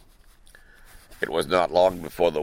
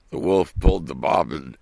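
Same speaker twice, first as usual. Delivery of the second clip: plain reading voice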